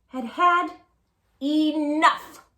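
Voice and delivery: put-on loud frustrated kid; fed-up boy. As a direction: push it out, had enough.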